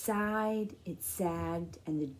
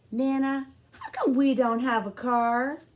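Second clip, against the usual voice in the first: a hint of a whiny kid, a bit louder; lightly voiced little boy; 6 semitones higher, 6 dB louder than usual. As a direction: complain like a small boy stuck in the rain. CJ asking.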